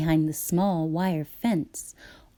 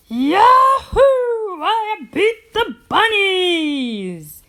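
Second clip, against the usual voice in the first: very high, loud victory yell; whoop of triumph; 12 semitones higher, 8 dB louder, slower than usual. Acted. Farmer celebrates beating the bunnies, loud whoop. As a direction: whoop like you've won. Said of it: "he whooped".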